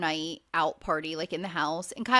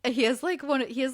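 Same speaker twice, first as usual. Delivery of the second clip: usual speaking voice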